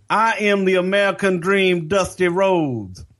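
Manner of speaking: mock incredulous Dusty; indignant Dusty. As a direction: say your own name like it's obvious. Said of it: "incredulously looks over at that cop and goes".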